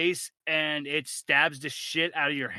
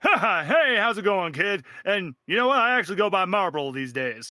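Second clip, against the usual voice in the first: high, loud, overjoyed greeting; delighted old acquaintance; 5 semitones higher, 4 dB louder than usual. Acted. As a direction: greet a long-lost friend. DM plays Marble NPC.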